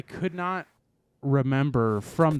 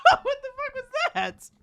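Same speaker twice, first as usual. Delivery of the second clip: laughing. Real, not acted. Laughing after weird robot call.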